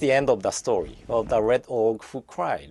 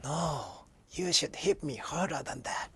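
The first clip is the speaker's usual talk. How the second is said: blue orc whispering, much softer; whispered; 10 dB quieter than usual. A narrator's reading. Blue orc whispers to red orc, hushed and soft. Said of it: "the blue orc whispered".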